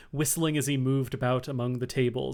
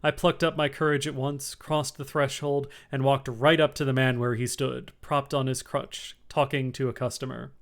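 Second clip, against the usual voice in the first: ordinary delivery, nothing marked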